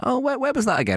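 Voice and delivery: lightly played forgetful elder; confused old man. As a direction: sound lost and muddled. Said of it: "I'm sort of like".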